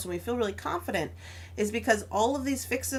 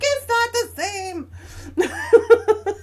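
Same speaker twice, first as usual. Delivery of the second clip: slow, higher, half laughing through tears; retold sob; 12 semitones higher, 7 dB louder, much slower than usual. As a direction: draw it out, laughing and crying at once. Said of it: "and so I'm crying".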